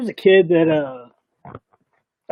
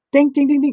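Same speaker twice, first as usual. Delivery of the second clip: high, louder dinging of metal on the street; metallic ding sounds; 12 semitones higher, 5 dB louder than usual. Retold or imitated. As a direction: ring it out like cutlery bouncing. Imitating silverware hitting ground.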